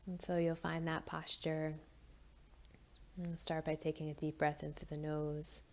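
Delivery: soft, even meditation voice; guided meditation. Acted. Leads a mindful breathing moment.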